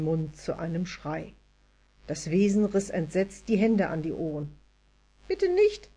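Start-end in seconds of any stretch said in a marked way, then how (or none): 5.3-5.9 high, hurried elf plea; hasty tiny voice. A narrator's reading. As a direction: blurt it out quickly, tiny and high. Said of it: "sagte es hastig".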